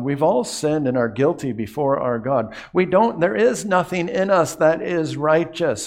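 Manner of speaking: ordinary talk, nothing marked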